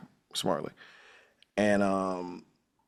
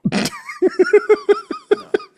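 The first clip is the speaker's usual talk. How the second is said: shrieking high laugh; 6 dB louder than usual. Real, not acted. Cracking up at the scene.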